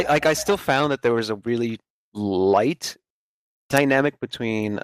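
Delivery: normal talking voice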